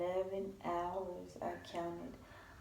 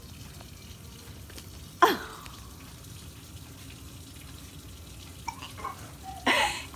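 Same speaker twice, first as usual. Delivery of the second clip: breathy laugh; 5 dB louder than usual. Real, not acted. Long laugh.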